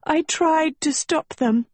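high-pitched tearful confession; weeping. Acted. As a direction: say it high, as if through tears. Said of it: "Agatha wept".

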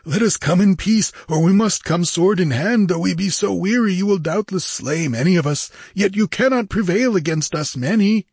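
high, insistent argument; clamoring pirate. A narrator's reading. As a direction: press the demand as a corsair. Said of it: "clamored a lean Shemite".

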